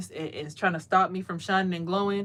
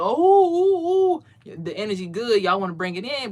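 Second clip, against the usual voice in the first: mock eager followers; 5 dB louder than usual. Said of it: "all the people who's here".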